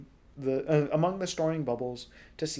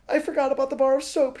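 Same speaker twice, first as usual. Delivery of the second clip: high, fast, breathless; panting; 13 semitones higher, 10 dB louder, faster than usual. Acted. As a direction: gasp it out in the wet heat. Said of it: "panting in the sudden wet heat".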